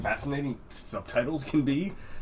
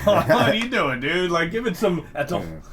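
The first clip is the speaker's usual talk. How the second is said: higher, louder mock protest; 5 semitones higher, 3 dB louder than usual. Mocking the trailer guy.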